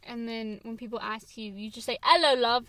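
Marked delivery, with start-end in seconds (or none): 2.0-2.7 playful mock British greeting; a breezy put-on British hello; lightly faked British accent. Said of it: "That's the only thing you can say".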